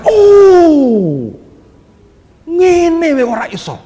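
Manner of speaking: high, loud crying oh, slow; dramatic realisation. Retold or imitated. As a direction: draw out a loud cry of discovery. Acted cry of realisation. (seruan "oh" panjang, tinggi dan keras)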